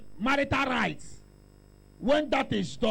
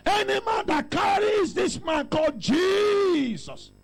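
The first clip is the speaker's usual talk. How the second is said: preaching at full force; 4 semitones higher than usual. Acted. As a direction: lift every phrase like a rallying cry.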